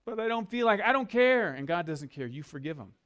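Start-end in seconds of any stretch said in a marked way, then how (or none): 0.0-1.5 high, loud whiny objection; mock whine, pitched up and loud; whiny protest. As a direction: play the sulking wife who won't forgive. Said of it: "You have no choice".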